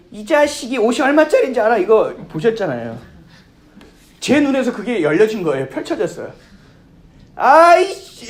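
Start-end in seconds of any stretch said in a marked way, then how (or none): 0.1-1.8 imitating a rich customer's louder, higher rant; bossy customer yelling. Preacher mimicking an entitled diner scolding a waiter, mock rant. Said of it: "드라마 보면 갑질 있죠". 7.4-8.3 a much higher, much louder shriek of outrage; angry shriek. Retold or imitated. Woman whose dress got splashed screams at the waiter, shrill outburst. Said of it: "그리고 소리를 지르더라고요".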